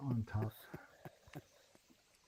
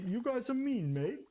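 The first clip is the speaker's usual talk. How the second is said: mock British accent; 10 semitones higher, slower than usual. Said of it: "supposed to say".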